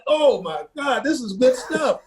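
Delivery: overjoyed. Real, not acted. Overjoyed retelling, pitch up.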